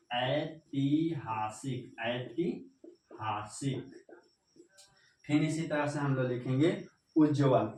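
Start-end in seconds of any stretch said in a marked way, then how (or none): 0.1-3.9 ऐतिहासिक said slowly twice, fading softer; slow dictation. Shown as a demonstration. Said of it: "तो इसी तरह से हम ऐतिहासिक". (धीरे-धीरे लिखवाना)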